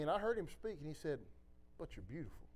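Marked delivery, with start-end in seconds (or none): 1.8-2.5 very soft, low murmur of reassurance; low and tender, barely voiced; tender reply. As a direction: imitate a hushed, loving voice answering gently.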